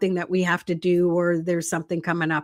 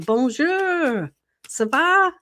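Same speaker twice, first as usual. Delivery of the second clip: French accent; 6 semitones higher, slower than usual. Real, not acted. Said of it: "I don't know a lot of French".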